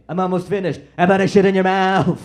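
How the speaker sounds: loud, high snapping retort; mock angry Trump. Trump snapping at an aide during his rant, loud mock anger. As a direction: fire it back at the aide mid-rant.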